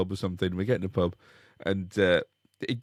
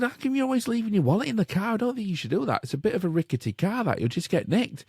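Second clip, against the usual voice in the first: retold question; 11 semitones higher than usual. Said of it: "And then I said".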